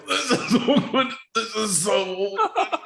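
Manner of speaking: high, strained falsetto mutter of embarrassment; mock awkward crowd. Teammates cringing at a bad skit, in a high mock voice. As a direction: squeak it out like a cringing room. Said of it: "everyone was just like".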